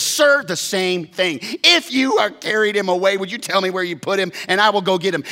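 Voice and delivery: high, loud frantic asking; desperate plea. Acted. Mary begs the gardener loudly and high.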